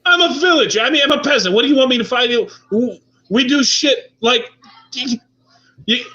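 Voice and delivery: high-pitched indignant complaining; exasperated villager. Acted. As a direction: push back like a cornered peasant. Villager protests being dragged along.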